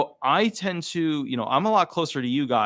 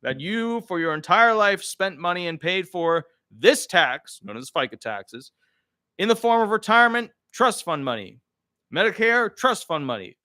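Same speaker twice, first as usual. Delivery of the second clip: higher, stately mock general; the government telling citizens about their taxes; general's voice; 6 semitones higher, 3 dB louder than usual. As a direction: pronounce it like a condescending official. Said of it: "the general's voice".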